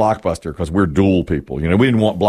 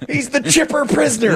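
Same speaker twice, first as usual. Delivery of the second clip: high, jingle-like title call; hammed-up chipper announcer; mock podcast intro. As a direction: announce it chirpily, pitched way up.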